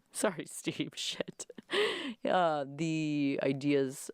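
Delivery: ordinary talk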